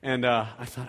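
ordinary talk

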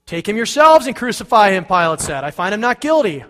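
angry governor shouting back; exasperated Pilate. Acted. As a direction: throw it back at the crowd in anger. Pilate arguing with the crowd.

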